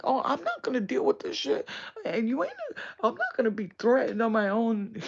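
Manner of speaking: high, loud indignant protest; mock outrage. Imitating woman refusing to be threatened, mock outrage. Acts out outraged reply, laughing after.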